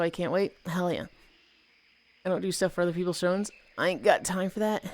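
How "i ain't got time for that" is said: usual speaking voice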